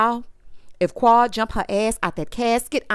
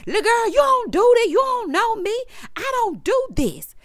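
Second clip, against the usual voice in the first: imitating hollering; 8 semitones higher than usual. Imitating Heavenly yelling at Sweet Tea, a high mock holler. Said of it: "Heavenly got to hollering".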